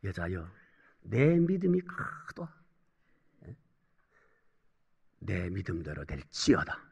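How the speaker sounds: very soft, low, solemn quote; hushed pronouncement. Acted. Jesus granting a mother's plea, in a hushed pronouncement. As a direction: let each phrase sink almost away.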